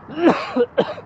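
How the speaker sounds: sharp choking cough; high choking cough. Real, not acted. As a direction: hack at something stuck. Just swallowed a bug.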